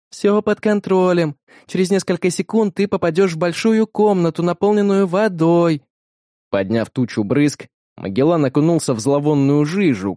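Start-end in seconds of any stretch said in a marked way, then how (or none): 0.1-5.8 steady her partner down; calm reassuring Beta. A narrator's reading. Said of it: "успокаивала Бета". (спокойный ободряющий голос Беты)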